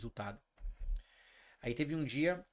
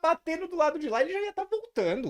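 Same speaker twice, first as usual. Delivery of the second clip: laughing through words; 14 semitones higher, 9 dB louder than usual. Real, not acted. Still laughing mid-anecdote.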